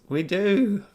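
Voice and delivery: high, loud burst of insistence; sudden excited exclamation. Real, not acted. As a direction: fire it back, amazed it's true. Insisting on an odd fact.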